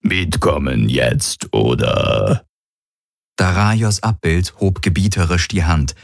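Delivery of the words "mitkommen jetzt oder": much lower, slow, croaked and burped; croaking, belching Kilfer. A narrator's reading. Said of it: "quakte und rülpste der Kilfer".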